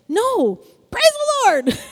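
sudden high shout of "praise the Lord"; retold shout of praise. Preacher reliving shouting praise alone at home, high shout. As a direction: re-enact breaking out in a shout at home. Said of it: "Like around the house shouting".

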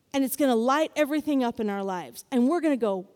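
ordinary talk, nothing marked

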